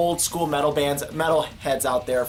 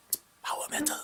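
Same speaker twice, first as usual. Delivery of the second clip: hushed reverent whisper, much softer; 11 semitones higher, 11 dB quieter, much faster than usual. Acted. Vlogger answering the skeptic, in a whisper. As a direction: breathe the genre name.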